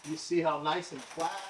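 usual speaking voice

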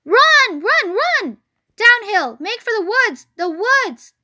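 high, loud urgent cries; hollering goose. Acted. Goose to fleeing Wilbur, urgent hollering. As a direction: yell him downhill to safety. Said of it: "she too started hollering".

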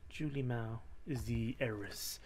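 ordinary delivery

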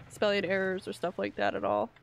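ordinary talk, nothing marked

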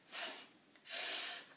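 f as a soft unvoiced hiss; phonics sound f. Shown as a demonstration. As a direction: let f hiss out with no vowel. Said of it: "the f sound".

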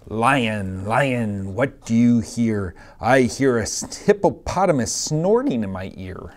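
lively sing-song picture-book reading; reading to children. Acted. Teacher reading a picture book to her class, sing-song. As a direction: read it out to a class of little kids.

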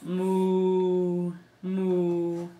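long, drawn-out moo; cow moo. Acted. Parent answering what the cow says, long mooing. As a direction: hold each moo like a lazy cow. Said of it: "What does the cow say?".

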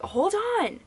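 high called-out reply to someone outside; calling out. Retold or imitated. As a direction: call it across the yard. Calling back to her brother outside.

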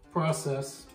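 ordinary talk

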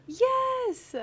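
a squealed, high, loud yes; thrilled yes. Real, not acted. Streamer thrilled by a viewer's gift, squealing. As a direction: burst out in delight.

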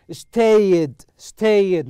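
"Stayed" said loudly with the ending sounded out; teacher's model of "Stayed", stretching into a distinct -ed; clear -ed ending. Shown as a demonstration. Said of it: "نطقت آي دي".

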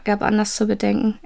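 usual speaking voice

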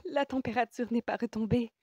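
high-pitched, eager exclamation; all excited. A narrator's reading. Woman telling the patient his fever remains, excited and high. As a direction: blurt it out, thrilled by the discovery. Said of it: "reprit-elle tout excitée".

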